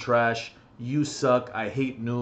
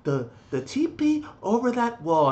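higher, over-explaining mock voice; condescending teammate; 5 semitones higher than usual. Gamer imitating a teammate who talked down to him, mildly condescending. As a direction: talk down as if to a child. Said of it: "purposely talking to me like an idiot".

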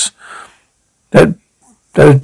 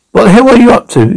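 startled demand; 6 semitones higher, faster than usual. Acted. Barging in on a noisy room.

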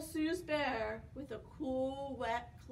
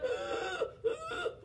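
high, airy mock wheezing; pretend wheeze. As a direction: wheeze as the mouse falls ill.

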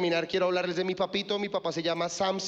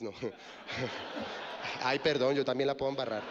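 ordinary delivery

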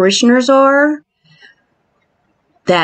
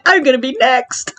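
ordinary talk

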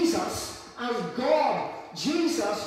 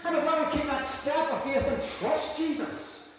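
raised, fast, pleading sermon; impassioned preaching; 3 semitones higher than usual. Acted. Pastor calling straying Christians back, impassioned. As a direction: call the strays home.

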